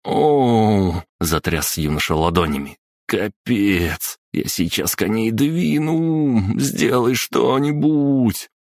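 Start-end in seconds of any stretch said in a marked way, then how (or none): 0.0-1.0 sharp cry of pain; pained yelp. A narrator's reading. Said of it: "ожохнул". 3.1-8.5 high, slow panicked wail. Acted. Hands hurting, panicking.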